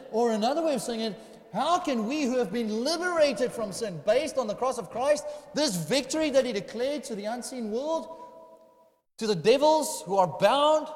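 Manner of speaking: high, loud rhetorical challenge from the pulpit; incredulous, emphatic sermon delivery; impassioned preaching. Real, not acted. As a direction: drive the question home with mounting force. Preaches at full force.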